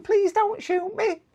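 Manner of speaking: high, slow squeaky plea; Worms. Acted. Game player pleading not to be shot, squeaky voice. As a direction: beg in a silly, very high put-on voice. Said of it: "Worms is going".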